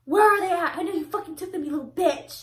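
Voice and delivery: high, loud mock yelling; imitated angry grandma. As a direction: holler accusations like an irate grandma. Angry grandma demanding her cigarettes.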